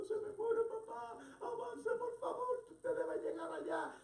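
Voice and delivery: very high, drawn-out mock wail; desperate tearful plea. Daughter to rabbi as papá dies, desperate tearful plea. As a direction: imitate a crying daughter begging him to hurry. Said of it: "aquella joven desesperada llorando".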